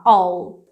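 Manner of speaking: "all" as the contraction of I will; contracted "I'll". Shown as a demonstration. Teacher modelling the contraction for students, said as "all". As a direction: run I and will together into "all". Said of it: "como se estivesse falando assim".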